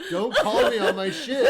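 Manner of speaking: helpless high, loud laughing protest; laughing hard. Real, not acted. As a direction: crack up while objecting. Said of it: "abs hurt from laughing so much".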